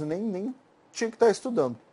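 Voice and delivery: ordinary delivery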